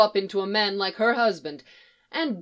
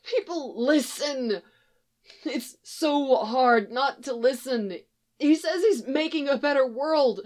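strained, higher voice reasoning its way to a verdict; pleading young stranger; 5 semitones higher than usual. Acted. Boy pleading with a mother about her son, higher and earnest.

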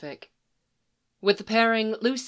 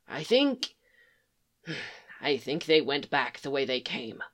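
sighing doubt; 5 semitones lower, 5 dB quieter than usual. Acted.

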